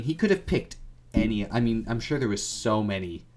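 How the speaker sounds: ordinary talk, nothing marked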